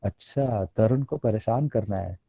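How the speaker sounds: low, knowing mother-in-law voice; a mother-in-law calling his bluff, low. Retold or imitated. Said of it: "मेरी mother in law ने बोला". (सास की धीमी आवाज़ में)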